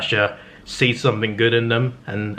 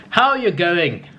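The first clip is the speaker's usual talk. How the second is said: attempted Australian catchphrase; 7 semitones higher, slower than usual. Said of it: "I'm not gonna do Australian accent".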